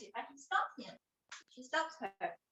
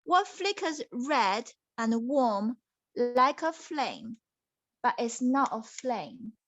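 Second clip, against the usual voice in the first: slow mysterious riddle start; slow riddle reading; slower than usual. Acted. Princess posing the second riddle, slow and mysterious. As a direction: drag out the riddle's first words. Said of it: "the second question".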